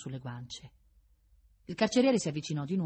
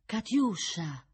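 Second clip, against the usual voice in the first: slow, drawn-out, higher appeal; pleading call; 6 semitones higher, 3 dB louder, much slower than usual. A narrator's reading. Prince trying to reach her by touch, pleading. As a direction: stretch the name out imploringly.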